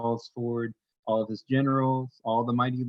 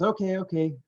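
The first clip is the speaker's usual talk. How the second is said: higher, eager little okay; eager Sable voice; 8 semitones higher than usual. Acted. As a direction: agree quickly, pitched up.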